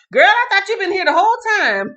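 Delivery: loud, high, rapid exclamation; real surprise. Real, not acted. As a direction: blurt it out in disbelief, way up high. Startled by viewer's late arrival.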